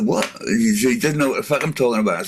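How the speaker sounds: ordinary talk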